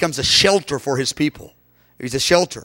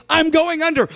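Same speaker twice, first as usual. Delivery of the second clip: drowning man; 10 semitones higher than usual. Retold or imitated. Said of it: "He goes".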